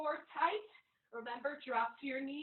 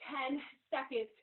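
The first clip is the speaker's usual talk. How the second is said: ordinary delivery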